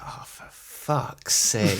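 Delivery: slow, breathless whisper of dread. Retold or imitated. Pupil seeing the angry teacher's name, dread whispered. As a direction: whisper it like doom just landed.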